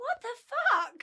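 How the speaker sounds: sudden shocked outburst; startled. Real, not acted. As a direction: caught off guard by Alexa. Startled by Alexa interrupting.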